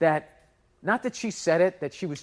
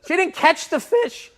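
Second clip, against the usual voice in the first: real voice cracking, loud and high with laughter; so worked up the voice breaks; 11 semitones higher, 5 dB louder than usual. Real, not acted. Venting about the lady, voice cracking with laughter. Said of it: "my voice is cracking".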